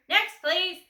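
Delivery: loud, high call; loud dismissal. A narrator's reading. Grocer loudly calling the next customer.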